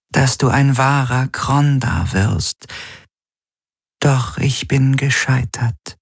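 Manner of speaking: high, slow, mournful voice in his head; a regretful spirit voice, drawn out; sorrowful inner voice. A narrator's reading. Shadow helix confesses failure.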